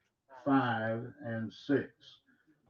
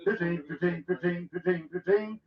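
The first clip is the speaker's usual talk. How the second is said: auctioneer; 6 semitones higher, 4 dB louder than usual. Acted. Auction bid calling continues.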